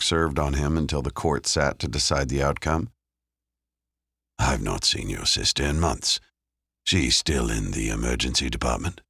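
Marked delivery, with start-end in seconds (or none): 4.4-9.0 a hint of a casual detective; lightly voiced man, curious; lower male voice. A narrator's reading. Dave voiced by narrator.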